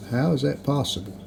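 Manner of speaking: ordinary talk, nothing marked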